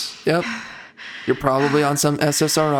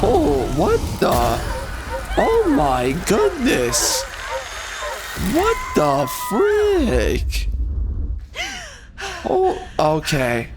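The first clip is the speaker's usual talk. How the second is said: much higher, stretched-out shocked cries; startled by a jump scare; 9 semitones higher, slower than usual. Real, not acted. Reacting to a jump scare, high shocked shriek. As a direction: yelp and drag out the disbelief.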